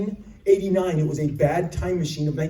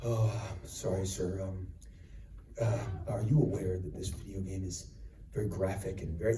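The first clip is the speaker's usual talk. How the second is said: much lower, softer, hesitant warning; hushed clerk; 11 semitones lower, 12 dB quieter than usual. Retold or imitated. Store clerk cautiously warning about the graphic game, low and soft. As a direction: tiptoe around the customer. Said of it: "clerk quote".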